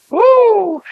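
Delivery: high, loud woo of praise; joyful preacher whoop. Real, not acted. Preacher whooping praise to the congregation, loud joyful woo. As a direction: let out a whoop.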